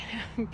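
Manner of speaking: quick amused laugh; a little burst of laughter; short laugh. Real, not acted. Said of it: "I'm laughing because".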